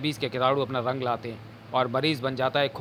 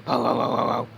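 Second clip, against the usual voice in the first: dog barking; 3 semitones lower than usual. Acted. Dogs barking.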